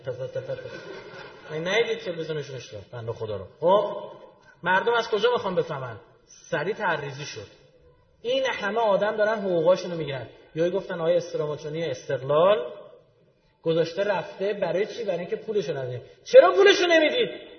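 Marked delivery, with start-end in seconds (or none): 0.0-1.5 slow, soft spitting stammer imitating a mangled FATF; mock sputtering. MP fails to pronounce FATF, mocked with slow sputtering. 16.2-17.5 much higher and louder, demanding why; mock indignant question. Mocking officials asked why they don't pay, loud and high.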